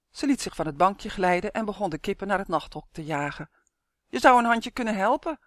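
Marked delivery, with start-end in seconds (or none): 4.1-5.4 loud, high, cross complaint; annoyed, raised voice; angry call. A narrator's reading. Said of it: "riep ze kwaad".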